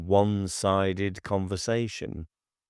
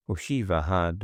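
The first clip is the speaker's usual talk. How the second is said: ordinary delivery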